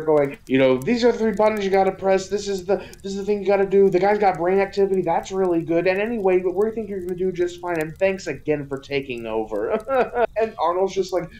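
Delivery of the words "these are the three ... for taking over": rattled-off mock instructions, very fast; rapid-fire briefing. Parade director briefing the stand-in, rapid patter. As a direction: rush through it like a harried director.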